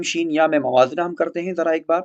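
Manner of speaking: normal talking voice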